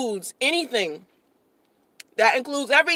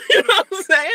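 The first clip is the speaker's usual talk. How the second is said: laughing; 8 semitones higher, faster than usual. Real, not acted. Laugh on the line.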